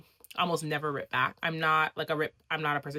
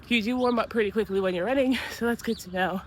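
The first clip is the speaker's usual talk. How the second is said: breathless, pitched up; out of breath; 6 semitones higher, 3 dB louder than usual. Real, not acted. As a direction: talk while still winded. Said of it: "I'm out of breath".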